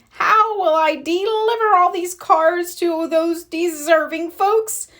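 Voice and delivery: a lightly voiced fretting postman, rising at the end; worried postman. A narrator's reading. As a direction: fret over the pile of undelivered cards. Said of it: "he exclaimed".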